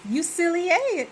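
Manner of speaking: high teasing "You silly, eh?" with "eh" tossed up; teasing tag. Retold or imitated. Teasing a relative for being silly, high playful. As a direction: tease them, pitched high. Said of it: "you be like".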